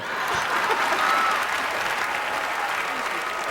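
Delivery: breathy unvoiced laugh; airy laugh. Real, not acted. Comic laughing after his lunch punchline, breathy.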